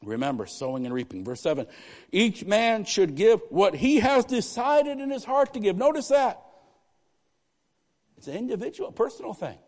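loud, high, slowed scripture proclamation; fervent scripture preaching. Real, not acted. Preacher reading the giving verse to the church, fervent. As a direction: proclaim the verse to the back row.

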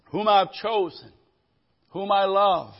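slow, loud, solemn voice from the cloud; voice of God. Acted. God speaking from the cloud, slow and solemn. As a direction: give God's words slowly, with weight. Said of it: "A voice came from the cloud saying".

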